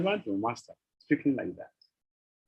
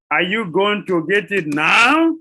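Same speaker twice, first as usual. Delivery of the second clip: loud, slow, higher demand swelling on the last word; impatient outburst; 5 semitones higher, 8 dB louder, slower than usual. Acted. The Lord to Sachimata over the garland, impatient shout. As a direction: demand it loudly, stretching out "now".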